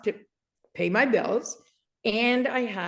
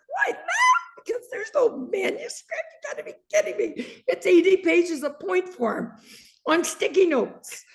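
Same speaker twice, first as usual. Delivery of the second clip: high-pitched laughing disbelief, much higher; 9 semitones higher than usual. Real, not acted. Telling listeners she had no manuscript, laughing hard. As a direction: laugh it out, giddy at the absurdity.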